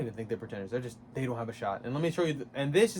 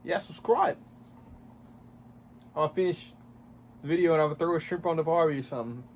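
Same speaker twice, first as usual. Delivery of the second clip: higher, louder mock Australian accent; hammed-up Australian accent; 3 semitones higher, 3 dB louder than usual. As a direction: ham up an Australian outro. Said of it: "Why am I doing an Australian accent?".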